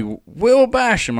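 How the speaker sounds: drawn-out, high hail of a name; slow mock-delighted call; retold excited greeting. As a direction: imitate a fan calling out a name, stretched. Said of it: "and he's like".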